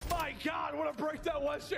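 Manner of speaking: amazed caster shout; excited shout. Real, not acted. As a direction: hype call of the play. Caster reacts to a break.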